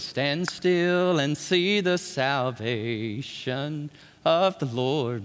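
very slow, low and hushed proclamation; solemn declaration. Retold or imitated. Moses telling the people to stand still, slow solemn hush. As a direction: draw it out with calm faith. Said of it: "Moses cried".

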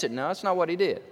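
ordinary delivery

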